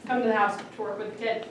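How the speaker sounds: ordinary delivery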